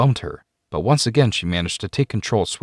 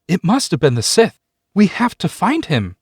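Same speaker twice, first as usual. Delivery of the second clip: much higher and louder with alarm; urgent plea; 10 semitones higher, 4 dB louder than usual. Acted. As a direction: insist desperately. Student pleads about kidnapped friend.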